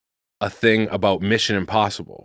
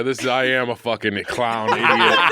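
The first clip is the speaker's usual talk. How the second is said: laughing. Real, not acted. Laughing at own mistake.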